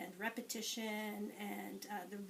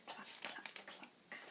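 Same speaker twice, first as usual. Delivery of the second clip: whispered clucking; chicken clucks; 13 dB quieter, slower than usual. Shown as a demonstration. Teacher showing children the chicken's sound, whispered clucks. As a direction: click out soft whispered clucks. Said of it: "and the chicken says".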